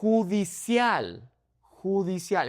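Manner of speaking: Judicial as hoo-dee-SYAHL, high pitched; Spanish "Judicial" with j as h; Spanish pronunciation. Shown as a demonstration. Teacher models the word, high Spanish Judicial. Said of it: "It's not judicial".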